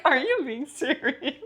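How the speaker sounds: high, slow incredulous question; mock disbelief. Friend teasing her bragging co-host, in mock disbelief. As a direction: draw it out high in playful disbelief. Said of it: "I'm looking at you like".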